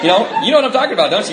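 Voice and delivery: normal talking voice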